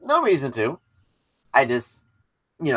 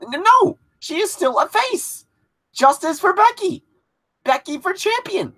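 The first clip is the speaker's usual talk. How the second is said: excited protest; 14 semitones higher than usual. Real, not acted. Passionate fan protest.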